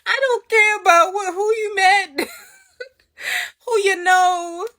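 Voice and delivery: high, loud, tearful-sounding complaint; exasperated whine. Retold or imitated. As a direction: wail it in mock despair, dragging the end. Acted inner plea to unwanted customer.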